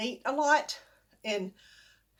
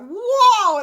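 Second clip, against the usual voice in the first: loud, high, amazed whoa; surprised whoa; 11 semitones higher, 14 dB louder than usual. Retold or imitated. Brother tasting the strong tea, a loud amazed whoa. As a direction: let it burst out, amazed at the taste.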